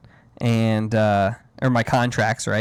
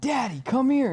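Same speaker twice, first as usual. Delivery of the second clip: little daughter voice; 14 semitones higher than usual. Retold or imitated. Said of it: "She goes".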